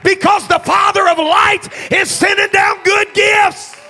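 loud sermon climbing into a high shout; preaching building to full force. Real, not acted. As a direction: drive it up to a shouted revival climax.

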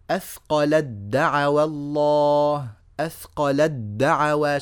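slow recitation, أثقلت دعوى run together as "athqalad-da'wa"; التاء merged into الدال. Shown as a demonstration. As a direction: hold a doubled d from أثقلت into دعوى, no t heard. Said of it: "دخلت من اللام إلى الدال على طول". (إدغام التاء في الدال في "أثقلت دعوى" بتمهّل)

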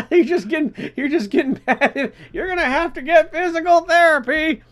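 high, laughing grandma impression; mock a fussy old lady, giggling. Mocking old people, laughing.

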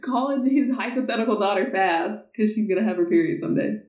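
ordinary talk

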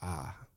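low, soft groan of dismay; exasperated groan. Retold or imitated. Peter asked the third time, a soft pained groan. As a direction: let out a pained sigh. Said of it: "Third time".

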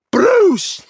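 very high, loud crash effect; imitated crash noise. All you heard when the bar fell, imitated crash noise. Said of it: "That's all you heard was".

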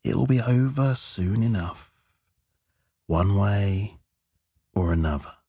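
slow, drawn-out threat, dropping at the end; sinister reassurance. A narrator's reading. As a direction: stretch it out menacingly. Gunman threatens weeping hostage.

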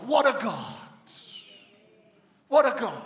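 loud praise exclamation; awed exclamation. Real, not acted. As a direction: burst out in praise. Exclaiming in awe of God.

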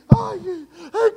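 high-pitched mock wail; llanto fingido. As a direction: imitate someone crying, hammed-up. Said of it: "y llorando". (quejido de llanto exagerado)

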